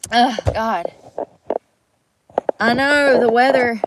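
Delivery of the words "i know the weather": exclaiming. Real, not acted. Exclaims about snow.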